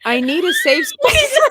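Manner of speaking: high, loud joking shout; mock shout. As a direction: shout it out playfully. Playful mock outburst.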